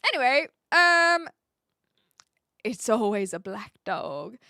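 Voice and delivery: hammed-up mystic reading with a sudden loud gasp; pretending to read tea leaves, dramatic and overdone; mock fortune teller. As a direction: play a fake seer reading tea leaves.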